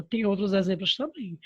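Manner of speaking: ordinary delivery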